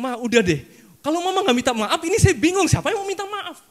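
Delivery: high, loud, laughing exasperation; exasperated plea. Retold or imitated. Son begging his stubborn mother to apologize, high exasperated laugh. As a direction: throw up your hands at mother.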